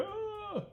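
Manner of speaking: very high yelp; scared scream. Acted. Bodhi runs from the bear, screaming high. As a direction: squeal as you flee.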